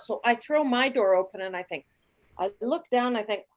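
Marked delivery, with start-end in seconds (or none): none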